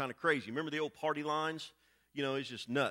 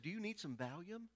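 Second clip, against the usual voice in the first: soft nurse's offer; 11 dB quieter than usual. Retold or imitated.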